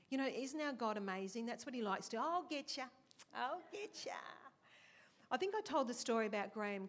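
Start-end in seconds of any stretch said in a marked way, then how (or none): 2.2-2.8 higher, teasing "I'll get ya" with a falling end; higher, playful "I'll get ya" that lands with a falling finish; playful God. Acted. 3.3-4.1 higher and softer, "get ya" rising slyly; a quieter, knowing repeat of the tease; hushed, high, sly "I'll get ya" ending on a rise; sly playful God. Acted. God playfully voiced, teasing.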